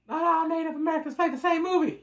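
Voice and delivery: high, loud indignant voice; high outraged voice. Retold or imitated. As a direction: keep the shrill outrage going. Continues the mock outraged voice.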